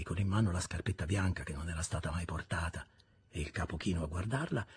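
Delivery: ordinary talk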